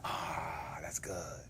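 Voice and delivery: soft, unvoiced satisfied whisper; hushed, contented whisper. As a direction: breathe it out in quiet awe. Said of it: "He was like".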